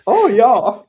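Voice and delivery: put-on high, loud hammy German accent. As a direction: keep the German voice going. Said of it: "Your German accent".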